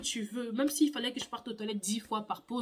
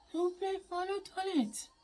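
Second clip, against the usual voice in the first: higher, softer choked plea; throat voice; 7 semitones higher, 5 dB quieter than usual. Retold or imitated. Pupil repeats plea to the teacher, higher choked voice. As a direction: squeak it out, barely audible. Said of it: "je commence à parler dans ma gorge".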